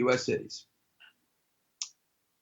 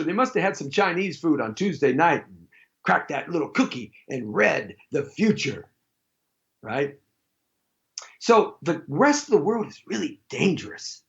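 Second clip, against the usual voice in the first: ordinary talk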